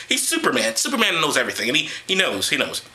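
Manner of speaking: ordinary talk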